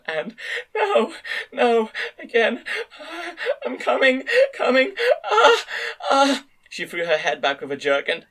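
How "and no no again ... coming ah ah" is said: very high, slow, tearful and sweet; melting, half laughing, half crying; meltingly sweet voice. A narrator's reading. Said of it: "a meltingly sweet sound".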